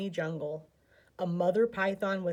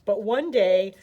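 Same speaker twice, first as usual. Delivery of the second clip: ordinary delivery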